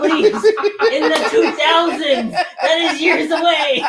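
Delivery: high, loud, laughing; laughing shout. Real, not acted. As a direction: yell it through laughter.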